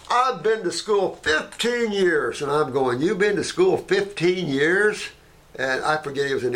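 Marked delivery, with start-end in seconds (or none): 0.1-2.4 higher, slower, self-important boast; mock pompous doctor. Arrogant doctor boasting of schooling, higher and slow. 3.0-5.1 higher, slow rising disbelief; incredulous echo. Retold or imitated. Mocking the doctor's boast back at him, higher and slow.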